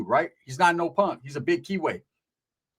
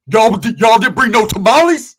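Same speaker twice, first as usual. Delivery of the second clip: mock loud complaint; 4 semitones higher, 11 dB louder than usual. Big inmate mocking the paisas.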